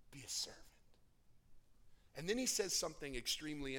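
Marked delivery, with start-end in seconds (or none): 0.1-0.6 whispered, much softer. Acted. Preacher whispering Jesus's answer to the congregation. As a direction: hush it down to a secret. Soft climax after build.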